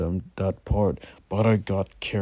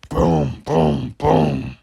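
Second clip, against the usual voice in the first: knocking sound; 4 semitones lower, 6 dB louder, much slower than usual. A narrator's reading. Knocking continues.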